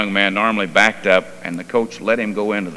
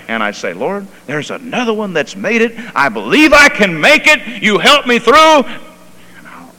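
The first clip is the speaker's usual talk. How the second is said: high, loud preacher's cry building to a peak; full-force preaching; 9 semitones higher, 8 dB louder than usual. Real, not acted. Preacher rejoicing over believers who made it, full-force preaching. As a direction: preach it out, voice soaring.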